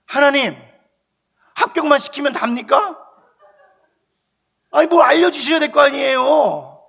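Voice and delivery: loud, high, desperate protest to God; shouted complaint. Retold or imitated. Frightened student protesting to God in prayer, shouting. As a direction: raise your voice in a retold outcry. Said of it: "큰 소리를 막 쳤어".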